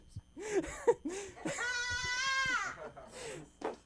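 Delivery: long, high, squealing laughter; helpless laughing fit. Real, not acted. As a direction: crack up completely, unable to go on. Breaks up laughing mid-bit.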